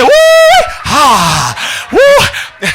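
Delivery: loud, high "woo" and "ha"; big hammed-up preacher whoops; excited whoop. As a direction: whoop it out at full voice.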